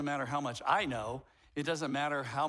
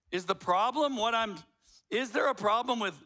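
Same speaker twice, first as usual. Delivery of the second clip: ordinary talk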